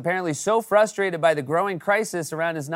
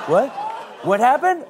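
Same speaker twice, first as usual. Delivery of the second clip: surprised; 6 semitones higher than usual. Real, not acted. Surprised reaction.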